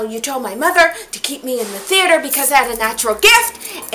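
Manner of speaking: high, loud eager calling after her; excited calling out. Retold or imitated. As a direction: chase her down, breathless and eager. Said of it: "I'm tearing after her".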